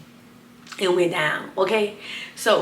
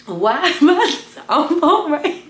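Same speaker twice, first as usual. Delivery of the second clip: breaking into high laughter; can't hold the laugh in; 8 semitones higher, 6 dB louder, slower than usual. Real, not acted. Laughing mid-story at camera.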